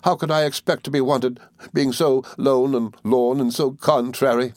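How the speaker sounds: high, slow self-pitying whine; lightly voiced widow, whimpering slowly; mild hammed-up whimper, high and slow; pitiable whimper. A narrator's reading. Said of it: "with a pitiable whimper".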